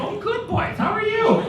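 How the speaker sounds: high-pitched gushing teacher voice; imitated teacher. Miss Robson greeting the boys at end of class, gushing imitation. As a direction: mimic a delighted teacher greeting her pupils. Voicing the cat-loving teacher.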